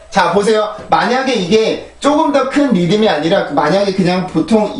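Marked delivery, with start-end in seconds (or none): none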